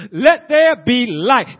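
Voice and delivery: slow, loud and high, a divine command; God commanding light into being; booming voice of God. Acted. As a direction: proclaim it like God creating. Preacher voicing God speaking creation.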